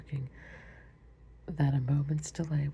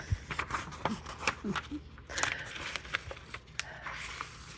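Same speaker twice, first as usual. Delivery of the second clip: soft laugh; 7 semitones higher, 5 dB quieter than usual. Real, not acted. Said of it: "I could barely read it".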